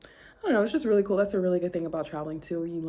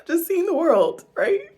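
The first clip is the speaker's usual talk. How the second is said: overwhelmed with gratitude, crying it out; real tearful crying; 9 semitones higher, 10 dB louder than usual. Real, not acted. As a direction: voice breaking, high and loud through tears. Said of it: "I don't mean to get emotional".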